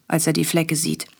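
plain reading voice

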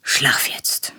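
whispered. A narrator's reading. Narrator whispering as Papa leaves the room. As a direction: whisper so as not to wake him.

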